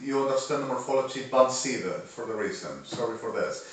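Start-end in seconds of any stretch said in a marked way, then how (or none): none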